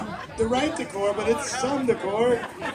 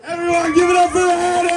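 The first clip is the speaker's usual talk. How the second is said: higher, louder crowd hype; rally the crowd for the contestant; announcer shout; 5 semitones higher, 5 dB louder than usual. Real, not acted.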